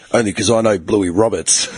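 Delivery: low, put-on gruff dad retort; old-man Aussie dad. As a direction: play the old man putting his kid down. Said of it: "you know, that voice".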